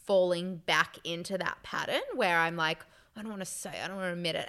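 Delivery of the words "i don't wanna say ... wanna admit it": soft, quick grumble to oneself; hushed re-enacted refusal to admit; reluctant mumbling. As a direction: say it under your breath, reluctantly. Acted inner reluctance to confess.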